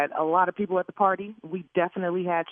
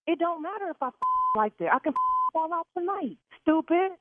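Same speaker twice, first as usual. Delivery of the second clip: angry; 6 semitones higher than usual. Real, not acted. Yelling at the caterer.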